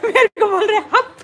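high, loud words through giggles; real laughing. Real, not acted. Mother amused that child shushed her, laughing. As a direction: speak while laughing.

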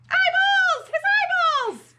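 very high, loud squeal; hammed-up storybook terror; horrified scream. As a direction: shriek at a gruesome sight. Bibi panics at onions.

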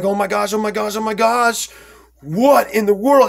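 high, repeated gasps of disbelief; retold stunned panic. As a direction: pitch it up, overwhelmed and baffled. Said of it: "You know, like".

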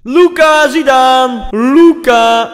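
slow, loud, drawn-out call; shouting the name. Pretend. Gamer shouting a player's name to get views. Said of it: "zijn naam schreeuwen".

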